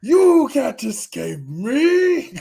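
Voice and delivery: very high, loud, long-drawn cry; hammed-up hero shout. Spider-Man shouting after fleeing Shocker, hammed-up. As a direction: stretch it out as a theatrical threat. Performs the wished-for chase line.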